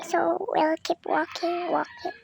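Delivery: slow, soft whisper. Real, not acted. Sneaking past Grandpa, whispering slowly to viewers. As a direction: creep along whispering.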